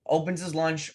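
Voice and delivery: ordinary talk, nothing marked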